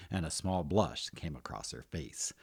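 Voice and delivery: ordinary delivery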